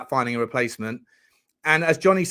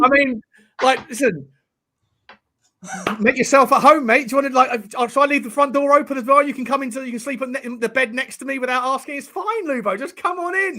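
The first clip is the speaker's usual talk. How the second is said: higher, louder sarcastic welcome; teasing a friend who barged in, higher and louder; mock hospitality; 8 semitones higher, 4 dB louder than usual. Real, not acted. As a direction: lay the sarcasm on thick.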